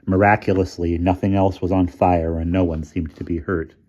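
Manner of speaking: ordinary delivery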